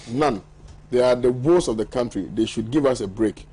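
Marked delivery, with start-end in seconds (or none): none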